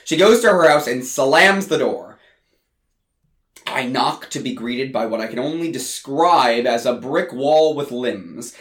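ordinary delivery, nothing marked

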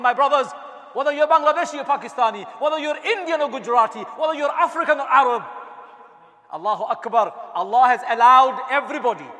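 loud, high, ringing call to everyone; impassioned call from the pulpit; rousing preaching. Acted. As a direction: lift the whole congregation. Preaching at full force.

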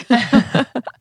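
bright burst of laughter at her own story. Real, not acted.

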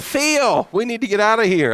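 sudden panic after the lightning strike; alarmed urgency. Retold or imitated. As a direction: friend urging they leave now, high and fast.

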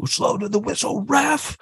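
retold fan yell. Fans heckling the referee at the game, mock high yell. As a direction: call out to the ref in a light mock shout. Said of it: "people have been yelling that".

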